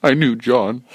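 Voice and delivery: lightly acted mourner giving a eulogy; funeral eulogist. Friend speaking at John's coffin, mock-mourning. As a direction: put on a halting funeral speech. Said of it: "here comes the first guy up on the stage".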